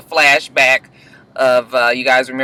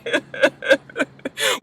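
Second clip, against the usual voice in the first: high burst of laughter; real laugh; 11 semitones higher, 5 dB quieter than usual. Real, not acted. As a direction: crack up at the family. Laughing at her extra family.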